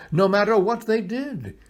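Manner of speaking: ordinary delivery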